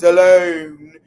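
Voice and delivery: loud, drawn-out "Alone" in a put-on voice; impersonating Carl Barker's drawn-out "Alone". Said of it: "I'm gonna say it in my Carl Barker voice".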